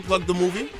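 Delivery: usual speaking voice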